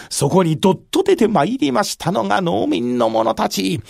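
ordinary delivery

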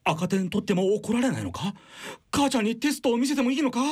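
put-on high, rushed kid worried about grades; anxious schoolkid. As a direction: gush it out like a scared pupil. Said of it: "数学のトラウマぶり返してる". (赤点に怯える子供の声)

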